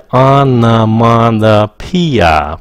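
"onomatopoeia" slowly, every syllable stretched; pronunciation demo. Shown as a demonstration. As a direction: sound out "onomatopoeia" as on-a-mat-a-PEE-a. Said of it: "Cái từ onomatopoeia nó phát âm".

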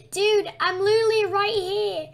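loud laughing yell; laughing shout. Real, not acted. Yelling at AFK teammate.

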